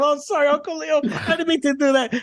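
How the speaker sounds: high, sheepish sorry; flustered apology. Retold or imitated. Host apologizing to Uncle Leo while cleaning him, flustered. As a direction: apologise while dabbing with a napkin.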